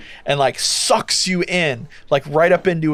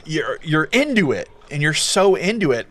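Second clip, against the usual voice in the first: ordinary delivery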